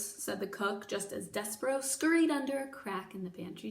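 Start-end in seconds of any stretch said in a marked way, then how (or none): none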